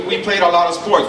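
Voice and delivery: ordinary talk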